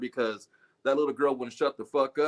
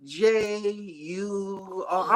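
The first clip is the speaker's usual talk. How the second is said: slow, proud letter-by-letter spelling, pitched up; spelling kid; 4 semitones higher, much slower than usual. Retold or imitated. Girl spells dinosaur wrong for her mom, slow and proud. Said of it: "I know how to spell dinosaur".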